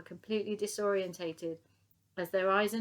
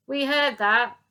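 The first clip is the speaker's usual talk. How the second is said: loud, higher police call; shouting; 6 semitones higher, 13 dB louder, slower than usual. Acted. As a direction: call it through the door. Police through door.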